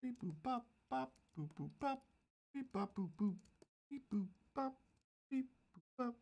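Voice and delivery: high soft robot beeps; robot beeping. Pretend. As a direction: beep like a little robot. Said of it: "Do one of these deals where".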